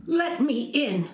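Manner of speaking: high, slow call at the door; mother's high call. Acted. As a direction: call through the witch's door. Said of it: "the mother called".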